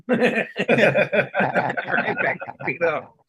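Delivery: slow, laughing through the words. Real, not acted. Telling friends he went back to Guido, laughing. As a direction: let the laugh spill over the repeat.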